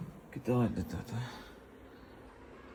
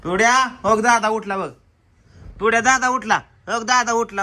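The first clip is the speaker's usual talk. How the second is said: excited announcing; 6 semitones higher, 8 dB louder than usual. Real, not acted. Excited announcement brother awake.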